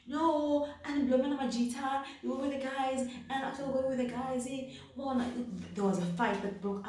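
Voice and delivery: high, rushed over-explaining excuse; rambling excuses. Retold or imitated. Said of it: "You just start explaining".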